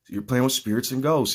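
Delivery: usual speaking voice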